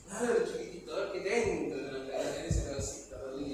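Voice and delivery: low, soft talking-down to a little one; patronizing parent. Acted. God coaxing a stubborn little child, patronizing parent.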